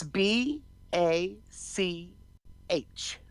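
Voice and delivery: spelling it out. Shown as a demonstration. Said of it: "Tell me her last name".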